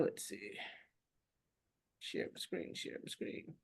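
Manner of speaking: very soft, whispered self-talk; muttering to self. Real, not acted. Host hunting for screen share, muttering to self. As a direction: think aloud under your breath.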